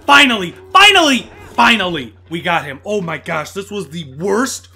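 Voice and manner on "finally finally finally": high, loud bursts of relief and victory; triumphant shouting. Real, not acted. Gamer beating the foe after many deaths, triumphant yell. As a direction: yell it as the winning blow lands.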